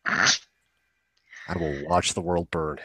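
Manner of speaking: slow, quiet mock supervillain boast; lightly hammed-up evil villain, slow and low-key. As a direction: drag it out like a cartoon villain plotting.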